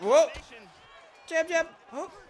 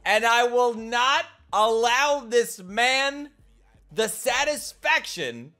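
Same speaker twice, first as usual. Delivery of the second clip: defiant vow; 9 semitones higher, 7 dB louder, slower than usual. Real, not acted. Vowing against trash talker.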